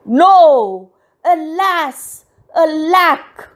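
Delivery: high, loud theatrical lament; anguished cry. A narrator's reading. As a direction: cry out like a thwarted prince. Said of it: "The prince cried".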